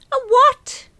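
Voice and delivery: very high, very loud shriek of disbelief; shocked scream. A narrator's reading. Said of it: "I screamed".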